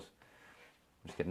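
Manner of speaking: hushed, slow whisper of a joke; whispered aside. Real, not acted. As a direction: drop to a breathy whisper, almost unvoiced. Aside after joking rant.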